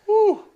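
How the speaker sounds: high, loud "woo"; sudden loud cheer; excited whoop. Real, not acted. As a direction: let out a delighted high whoop. Cheering the lamb roast answer.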